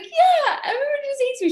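high-pitched mock sob; tearful insisting. Crying actress insisting to her dresser, mock tearful whine. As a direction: whine it like someone crying hard. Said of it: "just, like, started crying".

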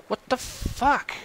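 high, slow, stretched disbelief; baffled outrage. Real, not acted. Gamer baffled by the hammer attack, high and slow. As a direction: drag it out, stunned.

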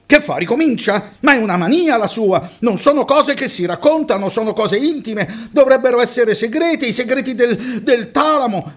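a woman scandalized, shouting him down; shrill outburst. A narrator's reading. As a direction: cry out in outrage at the driver. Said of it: "Strillò Claudia".

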